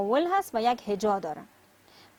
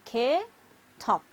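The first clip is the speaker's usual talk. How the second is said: "K, top" with a long gap between parts; split into syllables. Shown as a demonstration. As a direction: say "K", wait, then "top".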